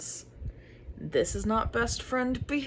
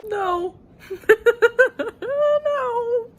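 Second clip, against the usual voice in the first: high, loud anguished laugh-cry no; laughing despair; 7 dB louder than usual. Real, not acted. Reader hit by a plot twist, laughing wail. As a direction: laugh through the heartbreak. Reacting to a sad book twist.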